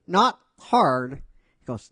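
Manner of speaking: usual speaking voice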